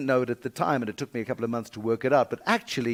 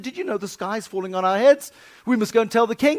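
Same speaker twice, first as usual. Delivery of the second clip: birds' alarm; 9 semitones higher, 7 dB louder than usual. Acted. Birds tell Foxy Loxy.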